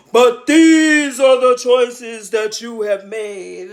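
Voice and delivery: slow, loud, thundering pronouncement; like many waters thundering; booming divine voice. Retold or imitated. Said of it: "sounds like a million waters".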